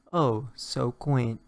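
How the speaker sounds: ordinary delivery, nothing marked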